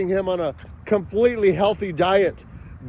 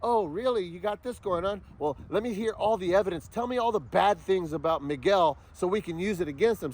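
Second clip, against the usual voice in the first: soft, sly lawyer voice, much quieter; mock a greedy attorney fishing for dirt, hushed; mock scheming attorney; 3 semitones lower, 8 dB quieter than usual. Mocking the attorneys to his viewers, soft sly lawyer voice. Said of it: "you know what attorneys do".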